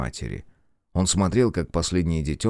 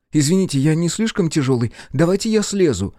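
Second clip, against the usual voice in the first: put-on higher, eager young voice; curious boy; 9 semitones higher, 4 dB louder than usual. A narrator's reading. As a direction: ask like a wondering child. Boy speaking in story.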